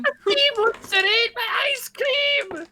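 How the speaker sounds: high, slow, hammed-up Scottish accent. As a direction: overdo the Scottish brogue. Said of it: "my terrible Scottish accent".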